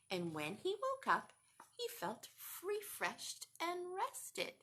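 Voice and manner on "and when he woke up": ordinary delivery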